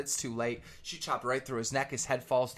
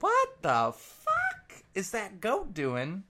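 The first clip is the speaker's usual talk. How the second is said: higher, slow baffled farmer voice; 8 semitones higher than usual. Acted. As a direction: drag it out, puzzled at the goat. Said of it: "The farmer's like".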